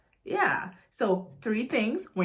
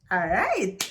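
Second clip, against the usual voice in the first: ordinary delivery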